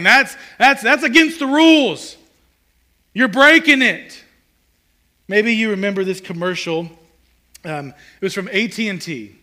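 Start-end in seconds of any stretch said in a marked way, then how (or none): 0.0-4.2 loud, high outcry with drawn-out endings; mock screaming protest. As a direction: cry foul like an outraged kid. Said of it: "We're really good at screaming".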